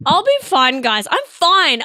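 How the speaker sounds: loud, high protest; comically defensive reassurance; over-insistent. Pretend. Insisting louder that she's fine.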